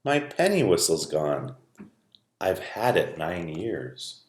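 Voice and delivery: low, soft sad voice; girl mourning her lost whistle, low and soft; tearful girl. Acted. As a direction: say it holding back tears. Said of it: "her eyes filled with tears".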